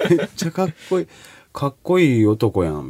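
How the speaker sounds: Kansai dialect, relaxed; 関西弁. Shown as a demonstration. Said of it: "関西弁で言うと". (関西弁で)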